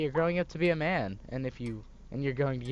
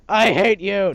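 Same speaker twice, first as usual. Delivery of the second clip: screaming I hate you; furious shout; 8 semitones higher, 13 dB louder, slower than usual. Acted. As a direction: yell it with full fury. Son screams at mother.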